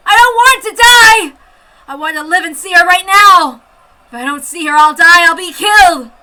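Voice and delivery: screaming fear of death; desperate sobbing cry. Acted. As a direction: beg for life in terror.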